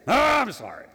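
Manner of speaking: loud, high, hammed-up oops; mock overdone apology. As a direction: toss off a big showy sorry with no real regret. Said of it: "that's the way a lot of Christians are".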